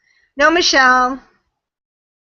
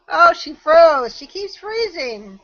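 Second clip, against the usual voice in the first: normal talking voice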